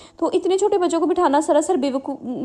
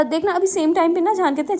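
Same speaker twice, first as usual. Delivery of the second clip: ordinary delivery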